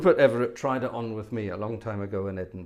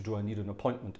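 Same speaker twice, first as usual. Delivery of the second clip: ordinary talk, nothing marked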